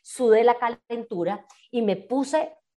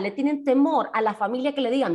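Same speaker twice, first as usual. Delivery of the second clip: normal talking voice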